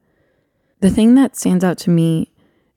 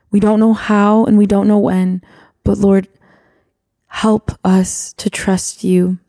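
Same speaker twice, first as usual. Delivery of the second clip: earnest spoken prayer, building louder as it pleads; heartfelt prayer; 2 semitones higher, 3 dB louder than usual. Acted. Wife prays for the listeners, earnest building prayer. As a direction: pray aloud with growing urgency.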